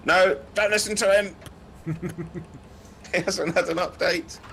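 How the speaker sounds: high, loud GPS butting in; sat nav voice. Pretend. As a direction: play a second sat nav arguing back. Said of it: "a schizophrenia, um, plugin for TomTom".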